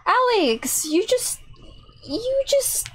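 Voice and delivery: stammering excitement that climbs up high; amazed. Acted. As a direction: build up the disbelief, voice climbing. Amazed at catching criminal.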